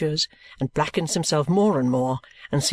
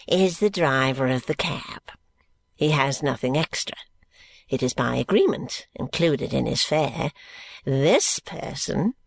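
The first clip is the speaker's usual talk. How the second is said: put-on slow, gasping, wheezing old man; a decrepit old miser catching his breath. Grandfather Smallweed greeting George, slow and gasping.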